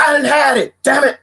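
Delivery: high, loud huffy outburst; fed-up lady snapping; hammed-up angry shopper; mock angry woman. Angry woman in grocery store.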